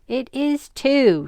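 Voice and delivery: mild shout back, slow and insistent; mother insists loudly from upstairs. A narrator's reading. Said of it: "shouted back his mother".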